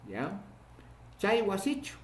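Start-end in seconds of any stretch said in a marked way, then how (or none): 1.2-2.0 "Chay wasichu" lifted high as a question; questioning tune. Shown as a demonstration. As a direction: ask "Chay wasichu", rising at the end. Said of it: "esto ya es una interrogación".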